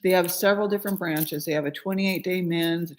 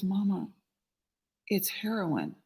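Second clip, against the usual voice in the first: quiet confession; 5 dB quieter than usual. Retold or imitated. Partner quietly reveals the drug.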